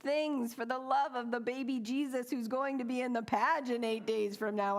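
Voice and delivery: hammed-up frantic holiday overload; frantic rush. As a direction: pile it on in a comic frenzy. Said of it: "I thought".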